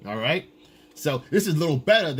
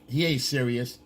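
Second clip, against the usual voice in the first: normal talking voice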